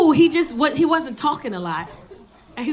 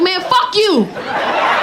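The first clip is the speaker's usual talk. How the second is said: slow, high, loud curse; mock angry retort; 8 semitones higher, 4 dB louder, much slower than usual. Comic telling the date off, slow and loud. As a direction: drag it out in mock fury. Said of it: "I was like".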